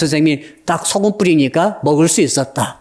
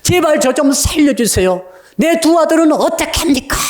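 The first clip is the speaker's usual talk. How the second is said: high, crying cry of a desperate mother; wailing plea; 9 semitones higher than usual. Retold or imitated. Mother begging the prophet for her two sons, high wailing plea. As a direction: plead through sobs, voice up high. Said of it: "울부짖는 거죠".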